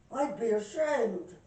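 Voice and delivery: loud, slow Irish punchline; Irish accent. Acted. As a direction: boom it out slowly in an Irish accent. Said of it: "I got me an Irish accent".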